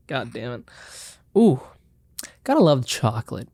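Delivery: ordinary talk, nothing marked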